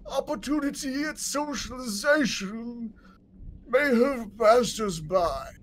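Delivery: high, slow, moaning undead noble; zombie lord. Acted. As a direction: call out like a lost old zombie lord.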